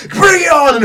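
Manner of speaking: high battle cry into the storm; defiant yell. Acted. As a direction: dare the storm at the top of your voice. Heroine voiced defying the storm.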